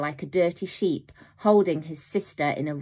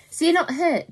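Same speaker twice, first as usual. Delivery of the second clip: worried; 11 semitones higher, 5 dB louder than usual. A narrator's reading. Worried brother asks.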